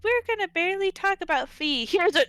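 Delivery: sarcastic imitation of the writers; mocking the writers. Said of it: "They were like".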